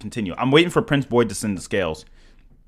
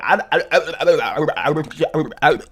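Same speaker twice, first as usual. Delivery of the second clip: rapid stammer breaking into a higher catchphrase; stuttering cartoon sign-off; 9 semitones higher, 4 dB louder, faster than usual. Streamer overwhelmed by donations, stuttering cartoon sign-off.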